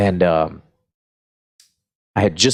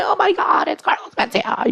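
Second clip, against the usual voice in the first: squealing much higher, like a fangirl spotting a celebrity; starstruck gushing. Pretend. Comic pretending the crew was starstruck, squealing fan. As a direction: gush over the star in a mock shriek. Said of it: "You know, like, no, we're just like".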